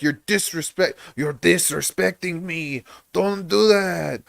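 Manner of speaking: mock outrage, imitating an offended stepfather; mad stepfather. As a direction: scold in a put-on accented voice. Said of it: "he got so mad at me".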